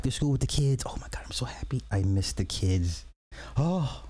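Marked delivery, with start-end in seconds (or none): 0.9-1.8 soft unvoiced whisper. Real, not acted. Telling chat about school with the kids, whispering. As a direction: whisper it like a secret.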